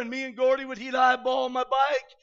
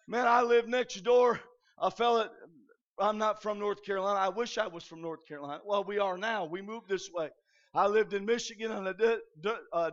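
ordinary talk

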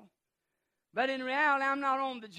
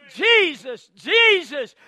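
loud, raised repeated call; crying out; 5 semitones higher, 15 dB louder, much slower than usual. Acted. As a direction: keep shouting the name, insistent. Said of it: "But he cried".

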